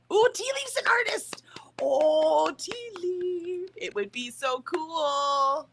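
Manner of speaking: high, drawn-out delight; excited. Real, not acted. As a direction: gush with delight. Admiring a viewer's art.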